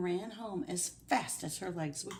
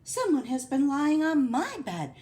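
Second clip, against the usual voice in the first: higher, louder middle-sized bear voice; Mama Bear; 8 semitones higher, 8 dB louder than usual. Acted. Mama Bear finds her bed rumpled, middle-sized voice. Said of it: "her mellow middle-sized voice".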